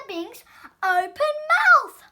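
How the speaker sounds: loud, slow, drawn-out reveal; dramatic reveal. A narrator's reading. Narrator reveals the donut is headed for a mouth, loud and slow. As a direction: land it like a shock.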